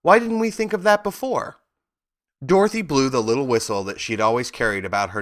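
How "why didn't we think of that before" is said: high, eager exclamation; bursting with a sudden idea; excited. A narrator's reading. Scarecrow's eager outburst.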